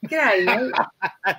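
laughing attempt at an Australian accent. Shown as a demonstration. Co-host echoes the host's greeting, laughing mock Australian. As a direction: have a go at Australian, amused. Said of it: "do you have an Australian accent".

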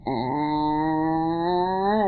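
long sustained "ah" sliding upward; yodel exercise start. Shown as a demonstration. Demonstrating the slow yodel exercise for listeners, gliding "ah". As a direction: hold an ah and glide it slowly up. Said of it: "where we started at".

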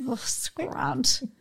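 slow, whispered and sly. Retold or imitated. Mum at the self-checkout, slow sly whisper. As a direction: hush it like a guilty secret.

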